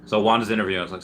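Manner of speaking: ordinary delivery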